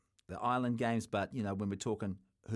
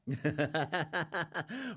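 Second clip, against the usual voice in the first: real laugh; 4 semitones higher than usual. Real, not acted. Laughs at Scott Robinson joke.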